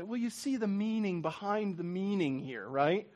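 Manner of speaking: hushed, knowing artsy type; lightly voiced pretentious art lover; artsy person. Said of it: "something an artsy person would say".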